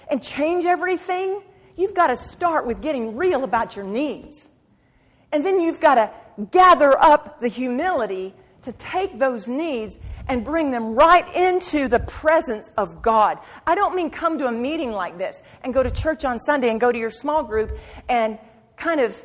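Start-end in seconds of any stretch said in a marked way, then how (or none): none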